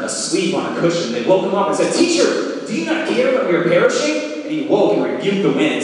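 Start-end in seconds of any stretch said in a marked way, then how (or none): 1.9-4.4 high, urgent cry of terrified disciples; frightened plea. Acted. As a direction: plead high and desperate over the storm. Disciples wake Jesus in storm.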